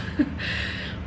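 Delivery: breathy laugh. Real, not acted. Breathless laugh after apology.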